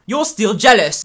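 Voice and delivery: loud, high-pitched jab; angry accusation. Acted. Accusing a jealous ex, angry and loud. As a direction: throw the accusation out loudly. Quarrel between exes.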